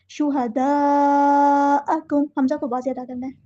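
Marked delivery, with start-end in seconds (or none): 0.1-2.3 शुहदाअकुम very slow, the madd held four or five counts; long connected madd. Shown as a demonstration. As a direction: hold the मद in शुहदाअकुम. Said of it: "four या five count में लंबा करना है". (चार-पाँच हरकत तक लंबा खींचना)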